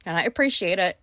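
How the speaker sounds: ordinary delivery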